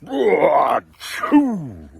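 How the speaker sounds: loud, drawn-out "ah-choo"; huge bear sneeze. A narrator's reading. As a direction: explode into a giant story-book sneeze. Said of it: "he blows and he sneezes".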